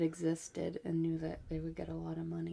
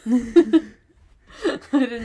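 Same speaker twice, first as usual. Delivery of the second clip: loud, high burst of real laughter; cracking up; 6 semitones higher, 15 dB louder, slower than usual. Real, not acted. Listener caught off guard by a story twist, cracking up. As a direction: laugh hard through the words.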